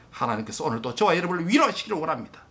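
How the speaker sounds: ordinary delivery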